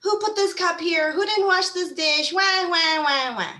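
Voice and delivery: high, loud nagging at the family; a hammed-up yell about dishes left out; mock angry yelling. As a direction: shout and nag about the messy kitchen. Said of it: "I would start yelling and screaming".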